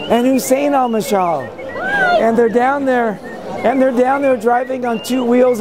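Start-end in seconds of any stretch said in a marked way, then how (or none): none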